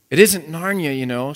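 lightly acted tearful protest; sobbing. A narrator's reading. Lucy pleads with Aslan, mildly tearful. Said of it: "sobbed Lucy".

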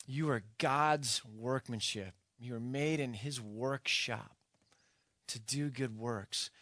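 slowed, measured preaching; slow and deliberate. Acted. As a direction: let each word land slowly. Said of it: "preacher slows for reflection".

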